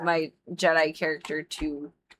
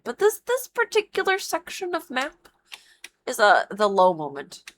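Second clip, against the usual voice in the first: mock complaint; 6 semitones higher than usual. Real, not acted. Mocking complaint about map.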